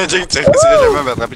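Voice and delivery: high, fast teasing voice; squeaky taunt. Acted. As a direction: taunt him in a silly squeak.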